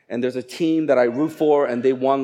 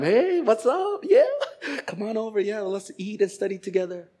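breezy friendly invite; 6 semitones higher, 4 dB quieter than usual. Retold or imitated. Friendly invitation before midterms.

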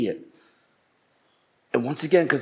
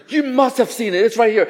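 high-pitched retold protest; upset insistence; 9 semitones higher, 3 dB louder than usual. Upset diner to the staff, high retold insistence. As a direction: insist in mock indignation, pitched up. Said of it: "So I was getting so upset".

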